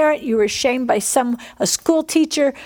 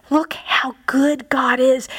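slow hushed whisper of awe; slower than usual. Real, not acted. Preacher hushes to the congregation, awed whisper. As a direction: breathe it out in awe.

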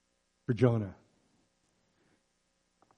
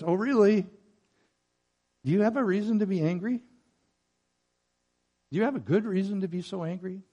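higher-pitched, gently challenging questions; confronting; 6 semitones higher, faster than usual. Acted. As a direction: question him with mock surprise, not shouting. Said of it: "just confronts his anger and says".

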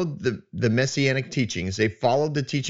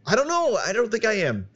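much higher, excited near-shout; yelling; 10 semitones higher than usual. Real, not acted. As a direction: let it burst out high. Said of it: "Bob, you're yelling".

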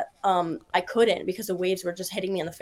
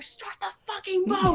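higher mock yell; 10 semitones higher than usual. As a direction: yell an urgent order. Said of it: "I, like, yell to the boat".

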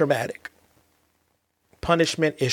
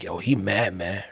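put-on kids murmuring in their room; 6 semitones lower, 3 dB quieter than usual. Kids in their room discussing their angry dad, hushed and low. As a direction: lower and quieter, like kids gossiping behind a door. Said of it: "you hear them in the room talking to each other like".

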